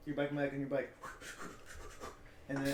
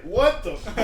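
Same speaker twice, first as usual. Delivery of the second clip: loud, high startled outburst; mock shock; 6 semitones higher, 12 dB louder, slower than usual. Wrong person hearing Hail Hydra whispered, loud mock-shocked reaction. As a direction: react loudly in hammed-up disbelief.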